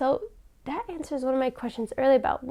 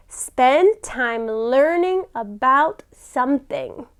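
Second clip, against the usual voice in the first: slow and clear; slower than usual. Shown as a demonstration. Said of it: "everybody say".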